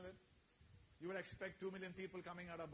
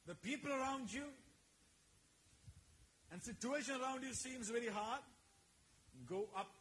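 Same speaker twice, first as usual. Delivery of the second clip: full-force sermon call; fiery preaching; 9 semitones higher, 6 dB louder than usual. Acted. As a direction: preach it out to the congregation.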